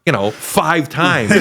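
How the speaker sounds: ordinary delivery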